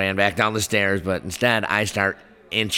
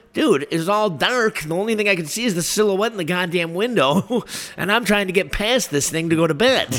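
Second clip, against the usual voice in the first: exasperated explaining; 11 semitones higher than usual. Retold or imitated. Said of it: "I'm like".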